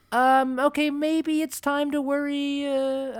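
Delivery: high, slow, mock-clueless drawl; mocking politician voice. Mimics politicians' response.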